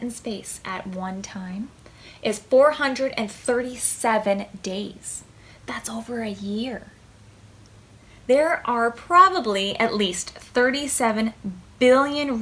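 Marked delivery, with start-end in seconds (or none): 5.7-6.9 soft whispered aside. Acted. Reader whispering to the child listener. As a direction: whisper it in amazement.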